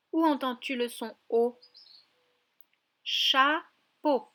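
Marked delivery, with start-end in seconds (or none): 3.0-4.3 "Chapeau" loud, split cha, long gap, po; syllable by syllable. Shown as a demonstration. Teacher repeats a word so the child hears O, syllable by syllable. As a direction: sound out "Chapeau" in two separate beats. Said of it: "dans la deuxième syllabe".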